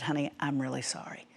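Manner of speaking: hushed, near-whispered retold apology; quiet, tender remorse, acted; soft apology. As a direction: say sorry gently to a hurt child. Retells soft apology to hurt daughter.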